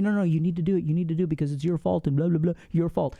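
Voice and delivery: lightly voiced pushy inner critic, rushed; nagging inner voice. Guilty mind pushing you to act, lightly voiced nagging. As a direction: rattle it off like a guilt-tripping inner voice. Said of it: "your mind is gonna tell you".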